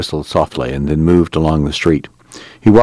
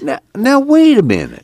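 much higher coaxing plea; high pleading; 4 dB louder than usual. Acted. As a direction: coax her, voice up high. Al calming the angry girl.

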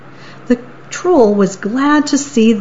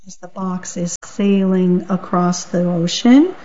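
usual speaking voice

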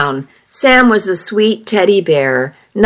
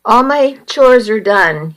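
ordinary delivery

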